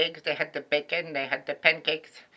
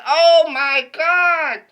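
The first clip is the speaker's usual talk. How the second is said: high, loud, drawn-out slow "oh my God"; shocked scream; 12 semitones higher, 12 dB louder, much slower than usual. Retold or imitated. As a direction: stretch the horror out in slow motion. Horror at being caught.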